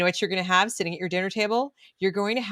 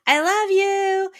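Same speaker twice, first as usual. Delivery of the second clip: higher, slow sing-song goodbye from mom; doting mom; 14 semitones higher, 6 dB louder, much slower than usual. Retold or imitated. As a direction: stretch it out sweetly like a mom at drop-off. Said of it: "and you went".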